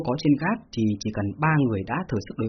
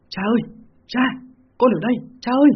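put-on high, loud girlish call for father; shouting like a young girl calling her father; faked girl's voice; 10 semitones higher, 5 dB louder than usual. A narrator's reading. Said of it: "cất tiếng la".

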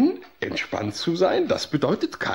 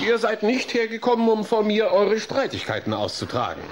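raised, higher voice putting a stop to it; stern rebuke; 7 semitones higher than usual. Acted. Therapist rebukes the quarrelling boys.

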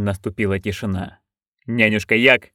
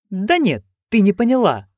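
put-on higher, eager old crone; Nanny Ogg voice; 10 semitones higher than usual. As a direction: pitch up into a gleeful old busybody. (высокий азартный голос старой ведьмы)

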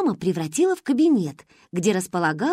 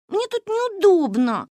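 put-on higher, slow, whining little girl; whiny complaint; 11 semitones higher, slower than usual. A narrator's reading. Little Gaiane complains to her sisters about her spot in bed, a slow, higher whine. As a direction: whine like a six-year-old. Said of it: "жаловалась она".

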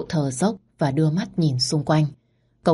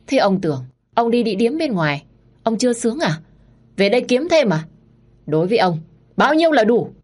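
jealous rage; 6 semitones higher than usual. Acted. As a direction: spit each question at him. Wife quarrels with husband.

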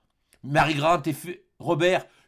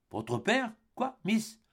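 softer, taken-aback questions; surprised; 5 semitones higher, 7 dB quieter, much slower than usual. A narrator's reading. Lord Glenarvan to girl at his feet, softly surprised. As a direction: caught off guard, quietly puzzled. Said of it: "surpris de voir cette jeune fille".

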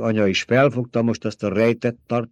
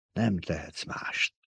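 soft, slow murmur; 9 dB quieter, slower than usual. A narrator's reading. The Truthsayer murmurs to the emperor, soft and slow.

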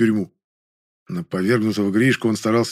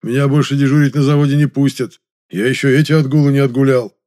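higher, louder anxious complaint; worried; 4 semitones higher, 6 dB louder than usual. A narrator's reading. Гришка frets to neighbour about work, worried. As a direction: fret aloud about the consequences. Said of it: "беспокойством спросил Гришка".

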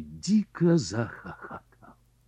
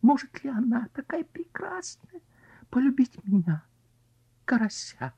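lovesick carp voice; 14 semitones higher than usual. Acted. Carp's lovesick monologue.

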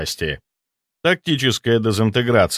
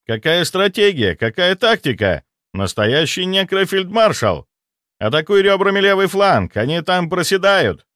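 excited cheering; 7 semitones higher than usual. Acted. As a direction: cheer on the fighter like a fan. Ghost excitedly cheers on tactics.

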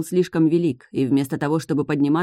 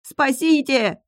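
much higher squealing cry for help; shrieking; 10 semitones higher, much slower than usual. Acted. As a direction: squeal it in panic. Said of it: "верещал Лински".

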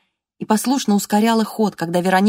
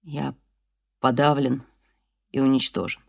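dull, hollow voice; 5 semitones lower than usual. Acted. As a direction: speak low, defeated. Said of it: "Его голос звучал глухо". (глухо и подавленно)

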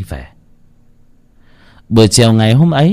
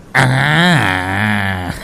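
triumphant chuckle; gleeful grab laugh. Acted. Son snatching mother's gold, gloating laugh.

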